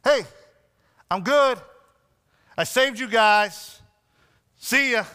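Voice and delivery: Jesus pretending to brush Peter off from the shore; dismissive brush-off. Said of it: "He didn't stand from the shore and be like".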